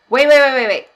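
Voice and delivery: loud, high, rapid-fire halt; urgent self-interruption. Acted. Parent stopping their own yelling, loud and urgent. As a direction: cut yourself off loudly.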